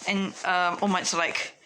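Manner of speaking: usual speaking voice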